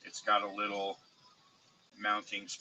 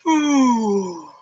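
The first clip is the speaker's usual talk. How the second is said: long weary blow of breath; tired exhale; 14 semitones higher, 17 dB louder than usual. Real, not acted. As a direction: puff out a tired whew. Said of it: "I'm tired".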